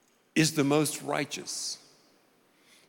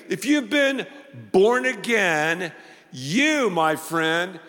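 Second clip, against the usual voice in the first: higher, emphatic preacher's delivery; impassioned preaching; 7 semitones higher, slower than usual. Real, not acted. Preacher assures the redeemed they are righteous, impassioned emphatic preaching. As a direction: build phrase on phrase, holding the key words.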